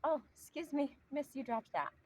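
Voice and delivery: high, sweet, courteous voice; polite. Shown as a demonstration. The nice way to confront a litterer, high and sweet. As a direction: lift the pitch and keep it kindly. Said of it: "You just have to say".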